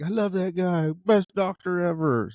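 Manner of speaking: a light hint of a gushing fan; mock fan. Patients praising the doctor online, lightly mock gushing. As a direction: gush a little about the doctor. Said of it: "everybody's gonna be like".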